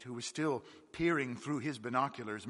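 ordinary delivery

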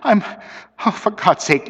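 high, shivering plea; teeth chattering. A narrator's reading. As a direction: plead through chattering teeth. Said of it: "I could hear his teeth chattering".

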